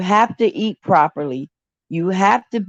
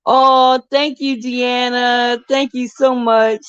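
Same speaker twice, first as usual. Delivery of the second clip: high, drawn-out grateful thanks; delighted thanks; 4 semitones higher, slower than usual. Real, not acted. Thanking a viewer for her gift with a high delighted voice. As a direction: gush with thanks.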